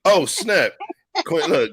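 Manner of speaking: high, loud happy greeting; excited. Real, not acted. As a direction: call out to a friend arriving. Greeting arriving guest excitedly.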